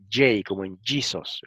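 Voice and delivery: J said the English way, "jay", as in "Jesus"; English pronunciation of J and Jesus. Shown as a demonstration. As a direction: say J and Jesus with the English soft-g sound. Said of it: "que tienen en inglés".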